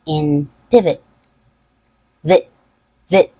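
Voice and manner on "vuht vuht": "vit" short, with a schwa; unstressed, schwa-like "vit"; reduced vowel. Shown as a demonstration. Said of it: "it has a reduced vowel".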